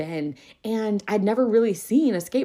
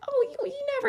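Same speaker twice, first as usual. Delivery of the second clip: much higher, playful disbelief; mock surprise; faster than usual. Teacher teases a student who says he won't place, high mock shock. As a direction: tease him with fake shock.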